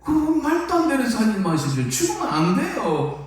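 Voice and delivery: 경상도 사투리로 항변하듯; Gyeongsang dialect protest. Retold or imitated. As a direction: argue in a Gyeongsang accent as if to stop him. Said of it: "이게 경상도 말로".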